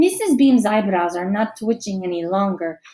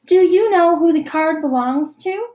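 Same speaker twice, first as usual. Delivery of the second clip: higher, louder teacher's question; teacher asking; 7 semitones higher, 5 dB louder than usual. A narrator's reading. Teacher asks a shy girl, higher and louder. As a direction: ask gently like a teacher. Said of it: "Missus Beam asks Lucy".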